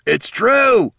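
high, loud shout from afar; calling out. A narrator's reading. Rudge pipes up from the crowd, calling out. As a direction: holler it over a crowd. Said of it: "Rudge called out".

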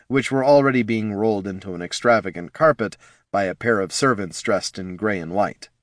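ordinary delivery

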